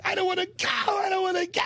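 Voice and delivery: high hammed-up pleading whine; Cheswick pleading. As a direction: beg not to be dragged off, high and frantic. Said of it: "He's going".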